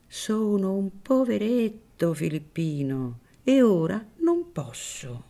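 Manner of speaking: put-on a wary old priest keeping his distance; Don Cirillo, wary priest.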